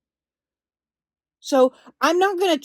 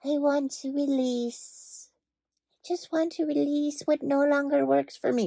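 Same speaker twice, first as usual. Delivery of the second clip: mocking spiritual talk; 7 dB quieter than usual. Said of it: "stupid word in the spiritual community".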